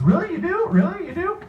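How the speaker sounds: very high, loud mock surprise; astonished audience member. Actor imitating surprised panel audience, high incredulous squeal. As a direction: squeak it like a disbelieving fan, hammed up. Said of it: "half the audience is".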